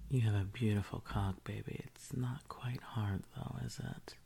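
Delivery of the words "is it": usual speaking voice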